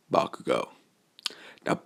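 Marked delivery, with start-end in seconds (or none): 0.1-0.7 low, whispered warning of the name; a quiet, menacing growl of his name; stern warning. Acted. Izuku glaring at Bakugo, low stern whisper. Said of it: "a stern look at Bakugo saying".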